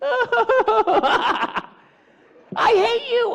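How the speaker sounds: high shrill mock cry of hate; whiny outburst. Hurt kid whines back at the bully, hammed up. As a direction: play a kid losing it.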